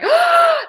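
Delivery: loud high gasp of delight; excited gasp. Retold or imitated. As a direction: gasp sharply, thrilled. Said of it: "and I was like".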